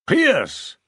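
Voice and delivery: loud, high burst of the name; a sharp, excited royal outburst; emphatic exclamation. A narrator's reading. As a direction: seize on the name with sudden force. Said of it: "his voice becoming very emphatic".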